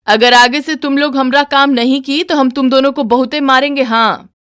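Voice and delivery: mother-in-law threatening to beat the daughters-in-law. Acted.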